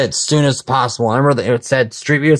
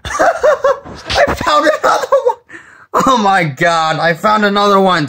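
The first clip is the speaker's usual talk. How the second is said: laughing excitedly; 8 semitones higher than usual. Real, not acted. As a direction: laugh out the thrill. Laughs at finding another one.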